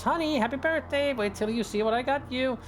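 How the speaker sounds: high, singsong call through the house. A narrator's reading. Wife calls to husband on his birthday, high excited call. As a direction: call brightly up high, storybook wife with a surprise. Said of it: "walks in the door and calls".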